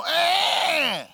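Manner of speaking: put-on high baby grunt toward the popcorn; a small baby's eager eh; baby noise. Baby reaching for popcorn, high little grunt. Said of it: "The baby looks in and go".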